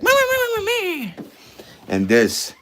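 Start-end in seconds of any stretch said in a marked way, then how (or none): 0.0-1.1 high little meows; cat meowing. Retold or imitated. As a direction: imitate a cat mewing. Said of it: "now she's like".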